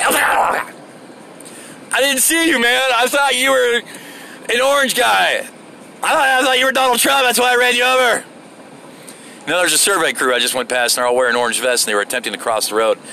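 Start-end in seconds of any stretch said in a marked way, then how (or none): 0.0-8.2 mock hollering driver. Driver to the man he ran over, mock hollering. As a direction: holler a mock excuse in a put-on voice. Acting out driver hitting orange guy.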